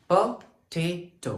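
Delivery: "Potato" slowly split into po, TAY, to; syllable by syllable. Shown as a demonstration. Teacher marking stress, "Potato" broken up slowly. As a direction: separate the beats of "Potato", holding the middle one. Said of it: "टे में हमारी energy भी बढ़ रही है".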